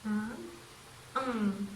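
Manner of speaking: a hummed "Um" with the tongue on the ridge; demonstrating "um" with the tongue behind the teeth; sound demo. Shown as a demonstration. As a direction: hum "um" to show the sound. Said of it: "like this".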